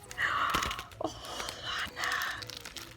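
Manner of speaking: soft whispered gasp of awe; breathy gasp. Real, not acted. Vlogger overwhelmed by the card, whispered gasp. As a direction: catch your breath in wonder.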